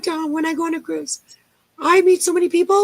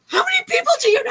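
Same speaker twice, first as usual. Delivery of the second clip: amazed husband; 13 semitones higher, much faster than usual. Retold or imitated. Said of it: "So he goes".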